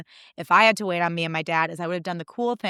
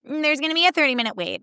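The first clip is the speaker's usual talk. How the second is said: higher, curt hostess voice; snippy hostess; 7 semitones higher, faster than usual. Retold or imitated. Hostess with a bee in her bonnet, snippy and higher. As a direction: give them attitude. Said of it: "There was a bee in this girl's bonnet".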